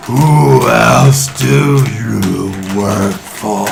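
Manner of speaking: very slow, loud alien question. Acted. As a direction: boom it out, drawn out. Alien master interrogates.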